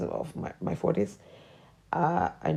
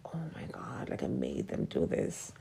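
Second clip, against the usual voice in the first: whispered inner thought; 4 semitones lower, 9 dB quieter than usual. Retold or imitated. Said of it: "I was like".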